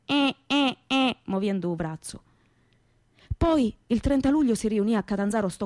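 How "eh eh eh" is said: short comic grunts, mouth open; mock the comic's "eh, eh" with a slightly higher voice; imitating Ciccio's grunt. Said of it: "fa sempre". (verso comico imitato, "eh, eh")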